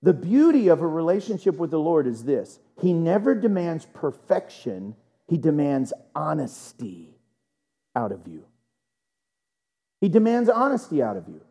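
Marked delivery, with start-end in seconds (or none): 5.3-8.4 low and soft, with a pause before "out of you". Real, not acted. Preacher telling church what God demands, grave and measured. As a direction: let "honesty" sink, pause, then drop "out of you" very low. Same line said twice, first weighty.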